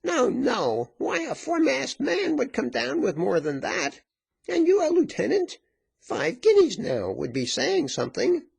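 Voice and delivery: high-pitched scoffing woman; haggling woman, pitched high and incredulous; indignant exclamation. A narrator's reading. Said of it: "exclaimed the woman".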